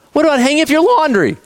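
high-pitched mock parental nagging; scolding parent. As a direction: imitate a parent piling on the scolding. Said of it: "scold you and say".

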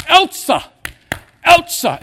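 very high, loud mimicked dog call; imitated owner's high-pitched holler. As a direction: call out sharply for a running dog. Imitates owner calling his dog.

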